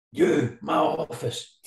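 slow, high barked order; mock furious summons; retold shout. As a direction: bark it out slowly like an angry manager. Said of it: "he was shouting in my face".

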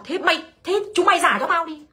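mock furious old man yelling; furious demand. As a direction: retell the furious old man demanding his money back, voice up. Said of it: "ông này ông cay quá".

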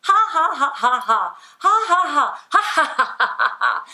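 loud, high volleys of fake ha-ha; deliberate, pumped-out laughing; forced laughter. Shown as a demonstration. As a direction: make yourself laugh, round after round. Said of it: "Then make yourself just".

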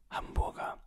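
normal talking voice

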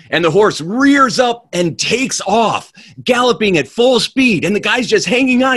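storyteller racing along with the galloping horse; excited. A narrator's reading. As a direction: tell it like the horse just bolted. Horse bolts at full speed.

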